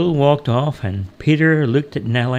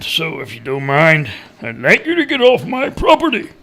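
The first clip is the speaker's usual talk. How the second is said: wily woodcutter; 7 semitones higher than usual. A narrator's reading. Woodcutter ordering the stranger off his land, wily character voice.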